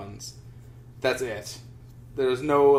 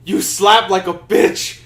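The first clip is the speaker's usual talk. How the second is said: drawn-out, higher mock yell; retold yell; 5 semitones higher, 9 dB louder, slower than usual. Slapped player taunting the guy who ran away, mock yelling. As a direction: call it out loud and slow. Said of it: "the dude yells".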